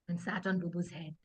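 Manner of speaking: usual speaking voice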